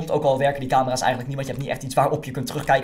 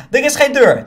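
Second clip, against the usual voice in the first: higher and louder, punching the reveal; playful reveal; 9 semitones higher, 10 dB louder than usual. Real, not acted. Teasing viewers that there's no door, playful loud reveal. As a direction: springs the joke on the viewers, no door.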